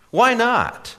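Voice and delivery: put-on eager young man voice. A narrator's reading.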